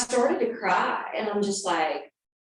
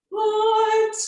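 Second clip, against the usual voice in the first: high, loud stunned what; shocked what; 6 dB louder than usual. Retold or imitated. Teacher recalling the moment she heard it, a stunned what. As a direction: blurt it out in shock. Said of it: "It was like".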